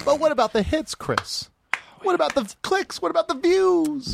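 high-pitched fretting put-on voice; mock worried fretting. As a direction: plead in a high mock whine, stretching the last word. Mock worry over internet hits.

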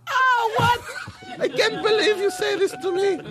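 shocked doctor. Acted. Doctor reacting to the patient's confession, shocked and shouting. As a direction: gasp it out in total disbelief. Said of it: "The doctor going shocked".

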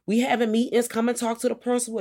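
put-on high, sweet luring call to the kids; fake-friendly teacher. Infected teachers calling students to the office, high and sugary. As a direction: lure them in like a creepy cheerful teacher. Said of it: "lining kids up ... like".

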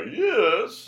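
high, loud, drawn-out comic cry; old comedy show character. Retold or imitated. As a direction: imitate a vintage show's pesky recurring foil, hammed-up. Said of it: "he would turn around and go".

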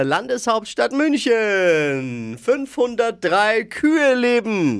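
high, slow teasing reveal; slow, drawn out. Acted. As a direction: stretch each word for suspense. Drawn-out punchline build. (langsam und gedehnt)

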